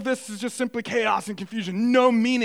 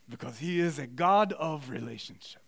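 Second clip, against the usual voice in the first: softer, lower German professor; German-accented lecturer winding down; 5 semitones lower, 5 dB quieter than usual. Retold or imitated. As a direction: settle gently, keeping the German accent. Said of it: "in German accent, I'm gonna do my best".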